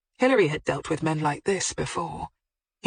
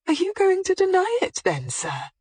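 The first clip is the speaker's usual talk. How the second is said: much higher, raised in disbelief. A narrator's reading. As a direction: voice lifted, mock incredulous. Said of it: "her voice slightly raised in disbelief".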